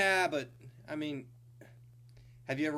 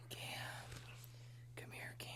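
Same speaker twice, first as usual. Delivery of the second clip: soft unvoiced whisper; 20 dB quieter than usual. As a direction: whisper it like a temptation. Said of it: "Whispering to you".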